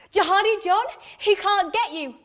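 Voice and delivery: high, loud, insistent activist; eager would-be rescuer; urgent reassurance. Acted. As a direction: press the news on a stranger. Woman's urgent reassurance, acted.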